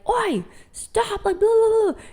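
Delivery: hammed-up impatient parent brushing it off; mock scolding. Parent snapping at a screaming toddler, hammed-up and high. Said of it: "you wouldn't be like".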